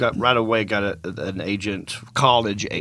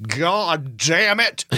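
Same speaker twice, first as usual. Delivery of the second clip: hammed-up slow angry holler; mock enraged yell; 5 semitones higher, much slower than usual. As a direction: imitate a furious roommate, dragging each word out. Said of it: "he got really mad".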